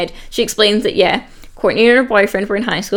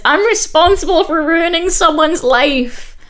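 mock crying; 7 semitones higher than usual. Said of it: "totally crying".

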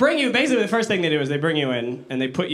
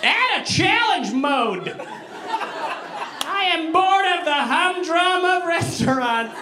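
high, drawn-out mock demand; jaded thrill-seeker; 10 semitones higher, slower than usual. Mocking diner who wants challenge mode, high theatrical complaint. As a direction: declaim it high and slow, theatrically bored.

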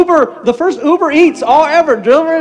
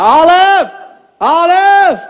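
two loud, higher calls with a falling end; calling out; 6 semitones higher, 5 dB louder, much slower than usual. Retold or imitated. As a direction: shout her name from room to room. Grandpa searching the house.

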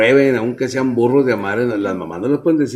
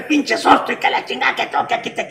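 mock raging father, voice pitched up and strained; hammed-up old-school dad yelling at his kids; imitating angry dad; 8 semitones higher than usual. Son mimics his strict father cursing at the kids, mock angry.

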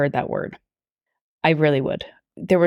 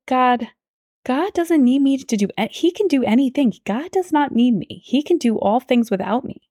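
higher-pitched, insistent disbelief; retold protest; 7 semitones higher than usual. Woman answering God's call, retold humbled protest.

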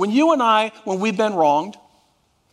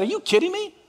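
much higher, mock disbelief; incredulous outburst; 10 semitones higher, faster than usual. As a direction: toss it off as if he can't believe it. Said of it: "I said".

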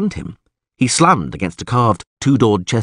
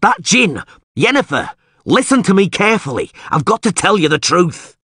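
high, loud, desperate warning; Geralt screaming; 9 semitones higher, 4 dB louder than usual. A narrator's reading. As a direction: shout urgently to make her listen. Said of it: "Geralt screamed".

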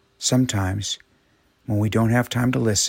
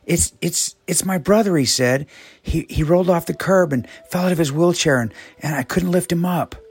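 high, halting, stammering through tears; a boy stumbling over words, crying; tearful stammer; 8 semitones higher, 4 dB louder than usual. A narrator's reading. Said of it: "With tears streaming down his face".

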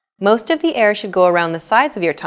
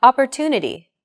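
Opportunity with TU long and stressed; 4 semitones higher than usual. Shown as a demonstration. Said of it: "Listen to it again".

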